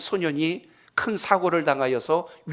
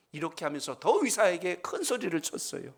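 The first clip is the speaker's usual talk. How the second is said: ordinary talk, nothing marked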